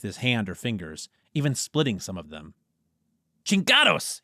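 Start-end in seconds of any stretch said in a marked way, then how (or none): 3.4-4.2 higher, louder Spanish curse; yelled Spanish swear; angry yell. Acted. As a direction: shout it out in frustration. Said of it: "he yelled".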